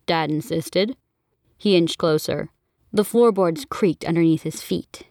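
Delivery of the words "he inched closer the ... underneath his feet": plain reading voice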